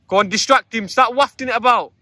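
high, loud call across a field. Real, not acted. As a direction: shout instructions to someone far off. Calling to wife from distance.

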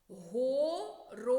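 slow whisper, «Горобець» split into syllables, third stressed: го-ро-БЕЦЬ. Shown as a demonstration. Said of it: "Вимовляємо слово по складах". (пошепки по складах: «го-ро-БЕЦЬ»)

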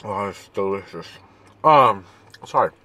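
chewing while talking; covering mouth while chewing; mouth full of burrito. Real, not acted. Seen 0: Bites burrito at -4, pursed full cheeks, hand covers mouth while talking.

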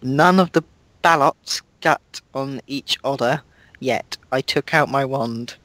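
usual speaking voice

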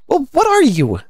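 high, loud bird character; high-pitched storybook challenge; challenging pigeon. Acted.

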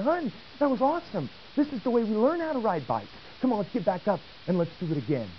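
high, upbeat coaxing back onto the bike; cheerful coaxing. Retold or imitated. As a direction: encourage her, a bit hammed-up.

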